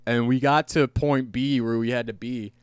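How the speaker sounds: ordinary talk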